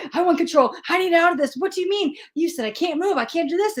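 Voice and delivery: high, frantic retelling of her panic; freaking out. Retold or imitated. Reader retells her own panic at Spirit, frantic high voice. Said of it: "and I'm freaking out".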